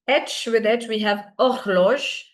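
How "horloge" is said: HORLOGE starting on the vowel, "or-lozh"; silent H. Shown as a demonstration. Teacher models the word for French learners, silent-H pronunciation. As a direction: slowly say HORLOGE without any H, throaty R. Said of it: "H is always silent in French".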